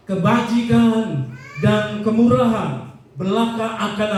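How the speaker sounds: preacher reciting scripture, deliberate and lifted; scripture reading. Acted. Reads the psalm verse aloud.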